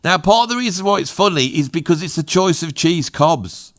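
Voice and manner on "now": ordinary talk, nothing marked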